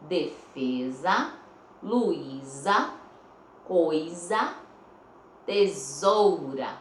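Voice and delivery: "Defesa, Luísa, coisa, tesoura" slowly, each S a clear Z; Z words recapped. Shown as a demonstration. Teacher recapping four Z-sound words, slow and deliberate. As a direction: list "Defesa, Luísa, coisa, tesoura" with rising ends, last one falling. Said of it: "o S está com o som da letra Z".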